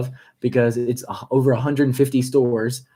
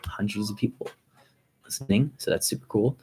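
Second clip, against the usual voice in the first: whispering; 2 semitones lower, 7 dB quieter than usual. Real, not acted. Excited aside about listeners.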